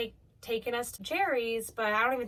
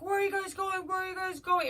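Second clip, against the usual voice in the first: higher mock yell; retold scream; 9 semitones higher than usual. Driver yelling after the fleeing girls, a pitched-up mock yell. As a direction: call after them, pitched up. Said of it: "So he screams".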